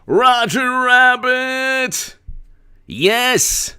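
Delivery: high, drawn-out cheer of the name; joyful outburst on the donor's name; excited shout. Real, not acted. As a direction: greet the donor with a delighted shout.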